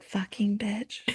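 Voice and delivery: soft mock toddler secret; imitated naughty whisper. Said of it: "next time he's like".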